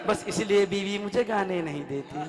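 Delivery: slow, lilting verse recital over music; stage poet reciting with a chuckle; poem recitation. Acted. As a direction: savour each line of the couplet.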